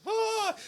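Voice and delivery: shrill high scream; crowd scream. Retold or imitated. Moviegoers at jump scare, high scream. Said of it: "the whole crowd's like".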